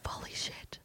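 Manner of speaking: breathless whisper of shock; whispered holy shit. Retold or imitated. Host retelling her starstruck reaction in a whisper. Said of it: "I was like".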